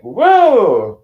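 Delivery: high, loud, drawn-out whoa. Acted. Streamer reacting to a wrecked sleeping schedule, loud whoa. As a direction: holler a long high "whoa".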